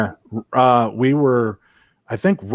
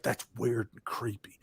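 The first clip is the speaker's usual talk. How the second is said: slow whispered Southern drawl; 16 dB quieter than usual. Retold or imitated. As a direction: whisper it, drawn out, imitating a spooked Southerner. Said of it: "that little southern drawl popping in there".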